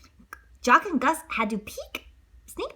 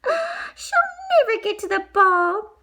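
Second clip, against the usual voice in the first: high mouse voice; 3 dB louder than usual. Acted. Mouse talk, gasp.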